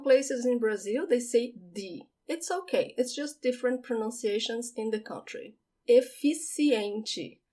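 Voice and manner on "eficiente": Eficiente very slow, C as a clear s; Eficiente, efissiente, slowly; careful pronunciation. Shown as a demonstration. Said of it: "pay attention to the C".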